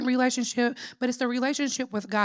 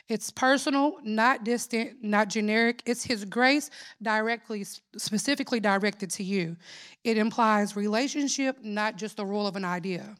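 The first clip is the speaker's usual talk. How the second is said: ordinary delivery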